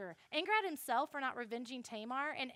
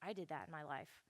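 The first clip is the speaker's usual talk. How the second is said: soft, low, quick self-reproach; hushed, guilty admission; sheepish confession; 6 semitones lower, 9 dB quieter, faster than usual. Acted. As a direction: admit it quietly under your breath. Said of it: "King David's like".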